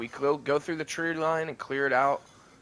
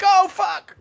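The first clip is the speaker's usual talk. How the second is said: shocked exclamation; 9 dB louder than usual. Retold or imitated. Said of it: "I'm like".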